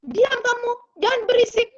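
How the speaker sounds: high-pitched imitated scolding; parent snapping at child. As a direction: act out a parent telling a noisy child off. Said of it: "Apa biasanya orang tua bentak apa".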